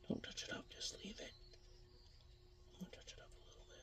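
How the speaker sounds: barely audible whisper to oneself; real hushed, voiceless self-talk; self-directed whisper. Real, not acted. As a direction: whisper it under your breath, talking yourself out of fussing. Self-talk while painting, trailing into whisper.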